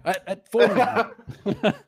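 slow, laughing restart. Real, not acted. Restarting his review while laughing. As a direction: laugh your way back in.

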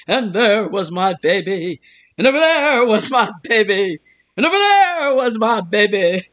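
slow mock lament, rising; joking rendition. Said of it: "he used to do a rendition of that where he would go".

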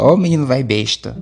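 usual speaking voice

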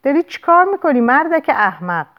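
mild, high-pitched scolding yell; narrated angry shout. A narrator's reading. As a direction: voice her outraged cry without full volume. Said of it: "آنوک فریاد کشید". (فریاد مهارشده با صدای زیر)

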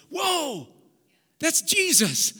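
high, breathless whoa of wonder; amazed discovery. Pretend. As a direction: gasp at seeing Jesus in the text. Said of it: "you're like".

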